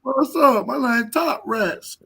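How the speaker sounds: high, drawn-out crybaby voice; whiny rival impression. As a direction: introduce yourself in the mocking whine. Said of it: "That's why he sound like that".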